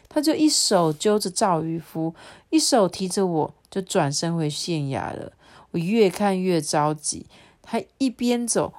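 ordinary delivery

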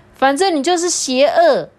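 high, loud indignant cry; son accusing. Acted. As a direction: accuse dad with childish outrage. Son accusing dad angrily.

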